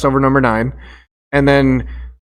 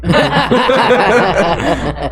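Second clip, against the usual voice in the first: burst of laughter; 5 semitones higher than usual. Real, not acted. Laughing at the story.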